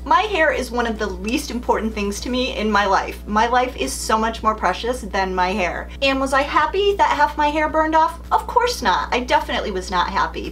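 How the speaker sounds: normal talking voice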